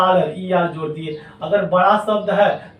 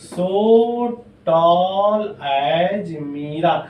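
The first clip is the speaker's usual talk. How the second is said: very slow, drawn-out example; slow board dictation; dictating slowly; much slower than usual. Shown as a demonstration. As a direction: say each word for the class to copy. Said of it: "teacher dictates negative example".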